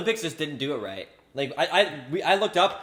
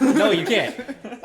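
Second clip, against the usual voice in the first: slow, drawn out through laughter; slow laughing; 7 semitones higher, 5 dB louder, much slower than usual. Real, not acted. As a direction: stretch it out, laughing. Drawn out repeat of line.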